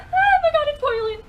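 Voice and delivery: high, loud startled cry; startled yelp. Real, not acted. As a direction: yelp at the scalding water. Burned by boiling water.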